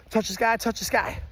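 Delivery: normal talking voice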